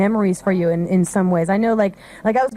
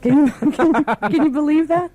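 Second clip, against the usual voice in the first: laughing, higher-pitched reaction; amused disbelief; 5 semitones higher, 4 dB louder than usual. Real, not acted. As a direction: laugh through it, stumbling into the question. Laughing after playing silly old commercial.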